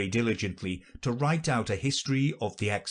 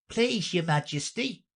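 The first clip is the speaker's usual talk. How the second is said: high, respectful appeal; high-pitched prisoner's plea; pleading knave; 9 semitones higher than usual. Acted. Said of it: "said the knave".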